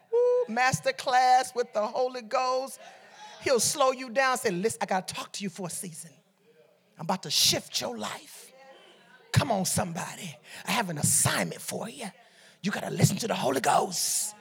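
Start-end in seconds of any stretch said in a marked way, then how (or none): 0.1-2.8 savoring exclamation. Real, not acted. Preacher savors the Holy Ghost's master class, whooping then slow. Repeats it with relish after woo. 4.5-14.3 soft, low, intimate counsel; hushed counsel. Acted. Preacher voices Holy Ghost promising an assignment, softly and low.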